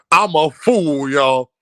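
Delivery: usual speaking voice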